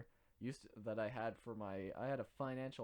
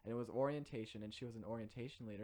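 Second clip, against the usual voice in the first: ordinary talk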